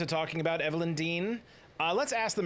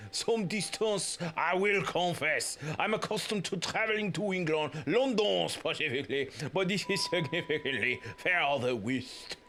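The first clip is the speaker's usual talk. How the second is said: bad French accent; 3 semitones higher than usual. Toussaint describing his travels, bad French accent. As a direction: struggle through an awful French accent. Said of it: "That's so bad".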